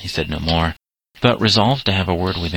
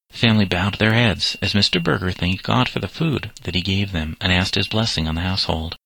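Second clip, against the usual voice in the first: usual speaking voice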